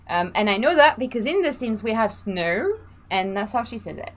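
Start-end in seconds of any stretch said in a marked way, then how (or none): none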